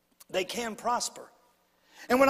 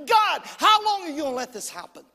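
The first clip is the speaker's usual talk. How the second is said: loud anguished plea, pitch raised; crying out to God; 4 semitones higher, 6 dB louder than usual. Retold or imitated. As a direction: call out to God in frustration. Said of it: "we're crying out and we're going".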